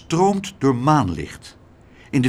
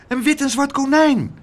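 high, louder excited cry; calling out; 14 semitones higher, 5 dB louder than usual. A narrator's reading. Abeltje describes the rabbit he found, calling out. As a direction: announce the find at a high pitch. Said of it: "riep Abeltje".

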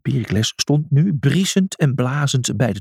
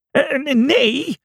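ordinary delivery, nothing marked

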